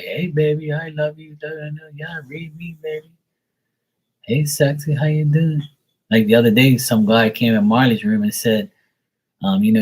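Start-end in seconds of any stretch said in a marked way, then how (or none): 0.0-5.6 high, hammed-up come-on; pitched-up parody of flirting; mock flirting. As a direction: imitate a guy sweet-talking a psychic. Imitating men flirting with psychics.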